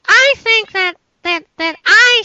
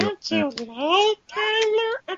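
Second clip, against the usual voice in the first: slow, softer put-on character voice; G2 Spark voice; 7 dB quieter, slower than usual. Guest teases the angry host, slow Spark voice. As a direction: draw it out in Spark's voice. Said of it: "that G two Spark voice".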